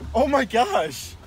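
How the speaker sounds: ordinary talk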